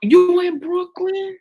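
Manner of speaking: high, drawn-out mock New York accent; put-on New York accent. Teasing Brooklyn co-host, high mock New York accent. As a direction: echo her in an exaggerated New York accent. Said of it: "I'm talking like I'm from New York".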